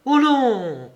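high, loud cry of refusal; a sudden, emphatic outburst; lively exclamation. A narrator's reading. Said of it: "s'écria vivement la madame d'Amercourt".

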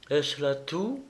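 flat chill, asking if that is all; cold tone. A narrator's reading. Anna, jilted, coldly asks the baron: cool and soft. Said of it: "demanda Anna d'un ton froid".

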